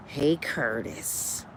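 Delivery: put-on low, slow purring old woman. [name] answers [name] on the phone, old-lady purr. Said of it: "She sound like [name], a old ass woman".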